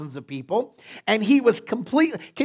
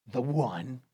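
dramatic movie-trailer aside; 7 semitones lower, 9 dB quieter than usual. Acted. Neo "the one" reference.

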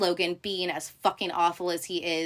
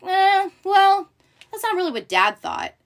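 taunting; 12 semitones higher, 8 dB louder than usual. Retold or imitated. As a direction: sneer it out. Roman taunting Kendall.

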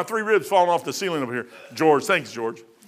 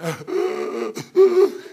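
a high hammed-up gasp; gasping for breath; 9 semitones higher, 4 dB louder than usual. Preacher acting out his fall, hammed-up gasp. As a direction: act out fighting for air. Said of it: "I'm out of breath on the ground".

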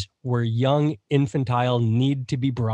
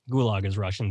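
usual speaking voice

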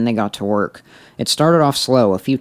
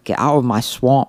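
Scottish ogre growl attempt; Shrek voice; 2 semitones higher than usual. Shown as a demonstration. Repeating the line back in a Shrek voice.

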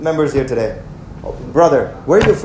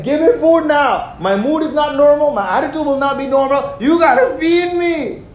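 higher, whiny demanding hungry voice; hangry crowd; 8 semitones higher than usual. Retold or imitated. Preacher voicing the hungry crowd, demanding and higher. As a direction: play a hangry crowd begging for food. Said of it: "That's how the people were".

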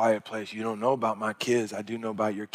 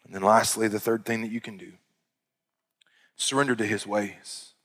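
normal talking voice

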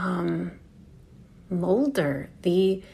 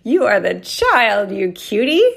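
teasing; 4 semitones higher, 10 dB louder than usual. Acted. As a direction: tease yourself fondly. Teasing her inner self.

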